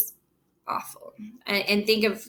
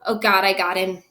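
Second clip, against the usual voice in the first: ordinary delivery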